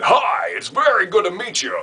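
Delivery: high, slick car-salesman greeting. Shown as a demonstration. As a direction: oversell it like a car dealer. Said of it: "you think they're gonna sell you a car".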